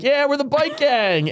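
high, slow mock bravado; mock proud. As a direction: proudly announce the gang, hammed up. Mock bravado of the bike gang.

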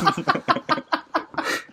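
high laugh. Real, not acted. Laughs at the teasing.

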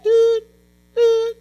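short, high-pitched monitor beeps; imitated machine beep. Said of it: "I could hear that machine".

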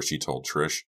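usual speaking voice

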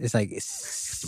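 "it's" whispered, the s drawn out; slow hissed s in "it's"; snake hiss imitation. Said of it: "sound like a fucking snake".

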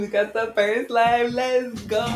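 high, loud, squealing greeting to her besties; bursting with excitement as she goes live; excited squeal. Real, not acted. Host greets her besties on her first live, squealing excitedly.

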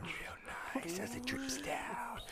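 slow breathy whisper; seductive whisper. Pretend. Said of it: "them a promo".

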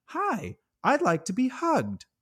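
high, sweet talking-doll voice. Retold or imitated. Horror fan mimicking the doll's recorded line, high and sweet. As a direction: chirp it like a toy doll. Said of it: "says the same old line".

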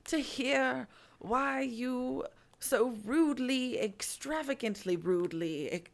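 slow, savoured mock-polite delivery; Dumbledore, playfully affronted. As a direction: linger with relish on each word. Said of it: "and he's like".

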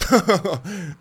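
loud, high mock guffaw; imitated scoffing laugh. Friend scoffing at a request for vlogging tips, imitated dismissive laugh. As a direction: laugh it off like a stupid question. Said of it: "like, laughed out".

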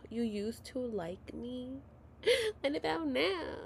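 mock-tearful, soft and wounded; devastated. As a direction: whimper it like a hammed-up heartbreak. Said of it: "I was devastated again".

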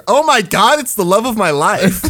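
loud, high gush; retold starstruck excitement. Teller relives meeting his idol, loud excited gushing.

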